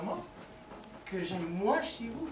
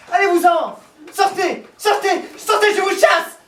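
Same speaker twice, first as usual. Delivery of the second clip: loud, higher, shouting him off the line; furious shout; 11 semitones higher, 12 dB louder than usual. Acted. To the intruding caller on the line, a furious shout. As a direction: bark him away, full fury. Wrong-number caller interrupts.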